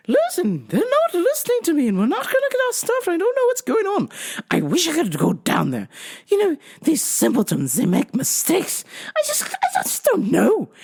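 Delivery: high, fretful English grumbling; mock-English customer in distress; phony English accent. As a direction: fret in a put-on English voice. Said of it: "phony English accent".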